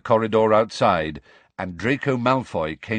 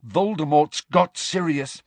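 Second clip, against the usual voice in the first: desperate thought; 7 semitones higher, 4 dB louder than usual. A narrator's reading. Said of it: "he thought desperately".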